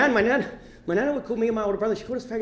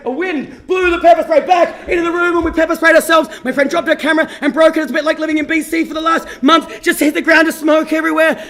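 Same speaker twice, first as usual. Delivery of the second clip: loud, raised, heated storytelling; excited climax; 5 semitones higher, 6 dB louder than usual. Real, not acted. Comedian recounting a pepper spray disaster, loud and worked up. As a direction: build the mishap up loudly, pitch raised.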